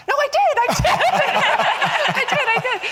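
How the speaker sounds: high, loud yell breaking into laughter; retold yelling. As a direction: yell it back insistently, half laughing. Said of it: "I'm yelling".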